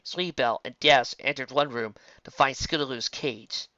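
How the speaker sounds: plain reading voice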